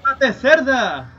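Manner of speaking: slow drawn-out shout; slow mocking gamer yell; stretched taunt yell. Real, not acted. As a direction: drag out the insult loudly. Taunting enemy while shooting.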